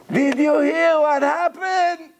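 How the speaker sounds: high, slow, almost crying; near tears. Retold or imitated. Broker breaking bad news, high slow near-tearful voice. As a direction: mimic a broker on the verge of tears. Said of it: "She's almost crying".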